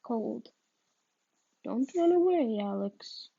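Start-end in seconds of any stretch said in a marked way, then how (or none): 1.6-3.3 commanding. Acted. Psychic halting the fleeing boy, loud command. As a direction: stop him cold with it. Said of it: "The lady speaks again, stopping me cold".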